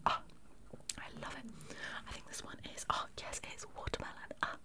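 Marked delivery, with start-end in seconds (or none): none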